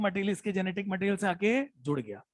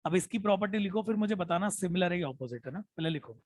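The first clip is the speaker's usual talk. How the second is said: ordinary talk, nothing marked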